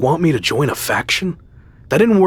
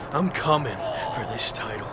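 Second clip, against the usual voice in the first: quiet threat; 2 semitones lower, 11 dB quieter than usual. Acted. Wrestler taunting the champion after the ambush, menacing hush. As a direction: lean in and hiss the threat softly.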